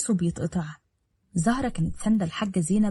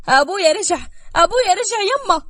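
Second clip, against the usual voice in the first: loud, climbing higher cry of joy; joyful shout; 12 semitones higher, 7 dB louder than usual. Acted. Daughter sees father's car arrive, shouting for joy. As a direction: yell it to the whole house, overjoyed. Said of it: "صرخت بفرحة".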